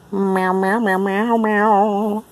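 mock meowing. Real, not acted. Owner meowing playfully at her cat. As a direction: meow back at the cat.

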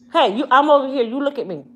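loud firm order; firm call to dog. Real, not acted. Owner getting her dog's attention, loud firm call. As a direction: get the dog's attention sharply.

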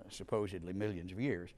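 ordinary delivery

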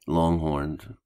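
usual speaking voice

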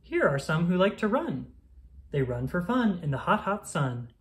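usual speaking voice

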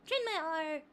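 ordinary talk

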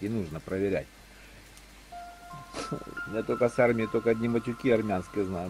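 normal talking voice

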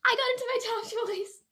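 high, breathless amazement; stunned joy. Real, not acted. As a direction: let the surprise pour out. After top choice acceptance.

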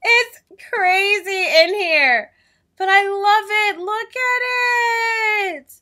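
loud, very high gushing; ecstatic squeal. Real, not acted. Gamer overjoyed with her room, ecstatic squeal. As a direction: squeal it, stretching the last words out.

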